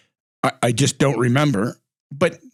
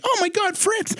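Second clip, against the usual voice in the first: much higher, delighted gasp of recognition; surprised recognition; 3 dB quieter than usual. Retold or imitated. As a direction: squeal his name as if you can't believe it.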